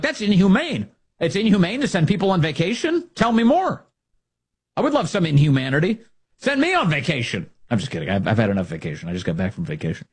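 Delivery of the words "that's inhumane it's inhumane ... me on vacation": mocking Democrat voice, pitched up; sarcastic impression of a Democrat, higher. Radio host mocks Democrats, sarcastic higher voice. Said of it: "All these Democrats are like".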